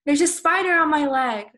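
loud, high cry of alarm; freaked-out yell. Shown as a demonstration. Teacher acting out spotting a spider on their leg, freaked-out yell. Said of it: "I would be freaking out".

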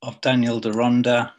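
measured poem recital with long pauses between lines; poetry reading. Acted. Poet reading to an online audience, measured poetry reading. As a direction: read it line by line, letting each phrase hang.

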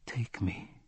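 breathless whisper. A narrator's reading. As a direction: whisper it close and intimate. Lover's intimate invitation.

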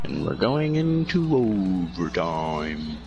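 slow, drawn-out announcer call. Pretend. Announces overtime like commentator.

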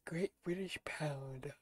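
slow hushed whisper. Real, not acted. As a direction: breathe it out slowly. Whispers currency name.

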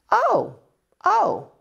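surprised high oh. Retold or imitated. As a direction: squeal it in amazement. Third lady surprised at name.

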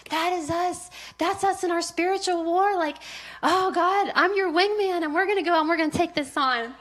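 loud, high, gushing battle cry; hammed-up rallying cry, breaking into laughter; fired-up excitement. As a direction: declare it like a pumped-up wingman. Acts out rallying reaction to film.

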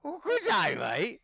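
put-on high squeaky Australian accent; mock Australian accent, squeaked. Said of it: "pretty sick Aussie accent too".

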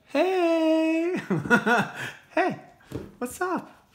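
high cartoon voice for the earbuds; playful gadget voice. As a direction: make the product talk to you. Voicing the earbuds greeting viewer.

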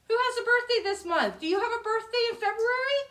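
excited. Real, not acted. Greeting children's birthdays.

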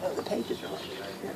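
usual speaking voice

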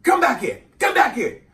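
high, loud yell from the aunt. Retold or imitated. Aunt yells the kid back inside, high and loud. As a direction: yell him back to the door. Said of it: "my aunt would yell back".

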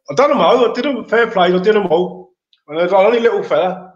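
high, loud triumphant boast; proud excitement. Real, not acted. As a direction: crow about the win. Proudly recalling beating them all.

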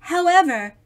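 usual speaking voice

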